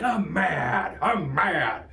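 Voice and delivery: hammed-up sudden grumble of anger; mock anger. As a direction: blurt it like a cartoonishly mad driver. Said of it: "all of a sudden you're like".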